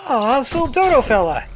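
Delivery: high, loud squeal of delight; real excited squeal. Real, not acted. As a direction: coo at the little bird. Delighted at cute bird.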